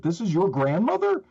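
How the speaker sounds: high-pitched retold disbelief; incredulous. Responder learns the boy knows the missing woman, incredulous. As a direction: re-enact an astonished question, pitch jumping up. Retold astonishment at the boy's answer.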